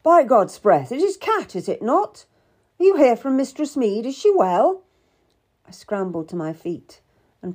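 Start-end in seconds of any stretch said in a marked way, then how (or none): 0.0-4.8 loud, high, startled questioning; exclaim, then question eagerly; surprised recognition. A narrator's reading. Seymour recognises Cat at his feet, surprised.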